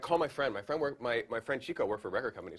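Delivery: ordinary talk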